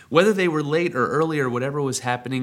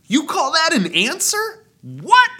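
high, loud disbelief; a frustrated prophet, much higher and louder; incredulous retort; 6 dB louder than usual. Retold or imitated. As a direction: throw the answer back in outrage. Habakkuk's frustrated retort to God.

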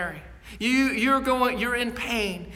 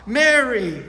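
calling her name; 3 semitones higher, 6 dB louder than usual. A narrator's reading. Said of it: "calls her by name with a tone that says".

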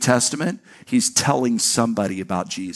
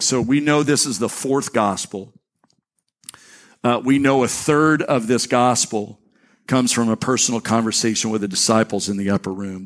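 ordinary talk

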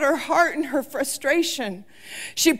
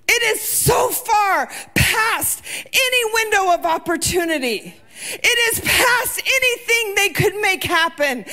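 impassioned preaching; 6 semitones higher than usual. Acted. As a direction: preach it out, climbing toward the peak. Preacher holds full force.

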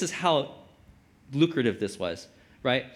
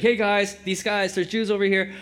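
calling out to the crowd; 4 semitones higher than usual. Retold or imitated. Slave girl calling out to the Philippi crowd, a mild mock shout. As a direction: hail the crowd like a tattling girl.

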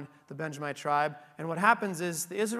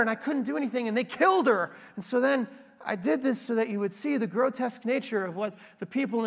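higher, louder innocent-victim act; self-serving liar; 5 semitones higher, 4 dB louder than usual. Retold or imitated. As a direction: play the wronged hero spinning lies. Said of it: "He looks really good in the story he tells".

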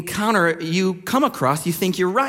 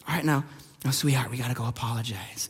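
put-on softer, lower, coaxing a little girl; gentle parent voice; 8 semitones lower, 8 dB quieter, faster than usual. Uncle coaxing his four-year-old niece to apologize, softly and gently. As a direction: soothe her into saying sorry.

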